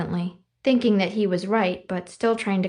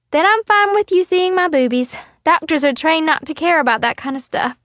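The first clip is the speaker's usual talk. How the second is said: put-on higher, louder cheeky girl; 8 semitones higher, 5 dB louder than usual. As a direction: joke brightly. Stevie Ray jokes.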